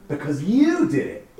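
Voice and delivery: high and loud, slow, stress on "you"; emphatic. Shown as a demonstration. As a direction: punch "you" in "you did it", slow. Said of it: "functions as the emphatic".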